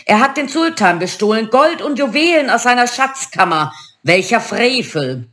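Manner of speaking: put-on high, indignant Arabic-accented genie; thundering Arabic accent. A narrator's reading. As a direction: declaim the accusation in an Arabic accent. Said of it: "donnerte der Lampengeist mit arabischem Akzent".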